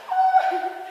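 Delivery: high, loud mock wail; hammed-up sobbing "ai"; choro fingido. Retells mother wailing at airport goodbye. (gemido de choro exagerado, bem agudo)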